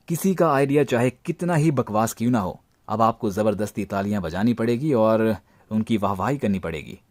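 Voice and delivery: ordinary talk, nothing marked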